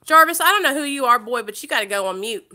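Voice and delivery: loud, sharp telling-off of a chat troll; scolding a troll. Real, not acted. Streamer scolds a troll in chat, loud and sharp. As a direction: put the troll in his place, loudly.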